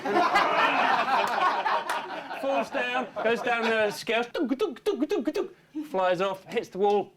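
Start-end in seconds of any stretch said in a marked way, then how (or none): 4.3-5.3 high, bouncing thud noises; imitated bumping. Shrek falls down the dragon's scales, high bumping noises. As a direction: mimic a bouncy tumble, pitched up.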